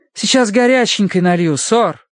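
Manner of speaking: put-on high-pitched, accented servant; eager accented maid. Maid promising guest hot water, eager accent. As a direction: chirp it helpfully, "sir" as "сор".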